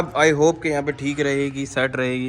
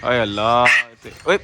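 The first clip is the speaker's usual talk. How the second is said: ordinary talk, nothing marked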